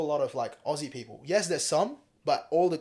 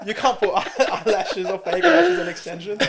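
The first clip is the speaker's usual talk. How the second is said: laughing; 9 semitones higher, 9 dB louder than usual. Real, not acted. Laughing about male grooming.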